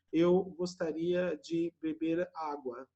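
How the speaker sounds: slow, each word apart; separated words. Retold or imitated. Dinner guest answers in stiff separated words, slowly. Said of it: "fala assim".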